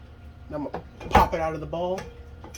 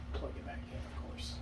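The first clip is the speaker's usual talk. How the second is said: whispering; 4 semitones lower, 16 dB quieter than usual. Real, not acted. Unvoiced aside while working.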